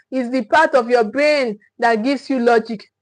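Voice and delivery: ordinary talk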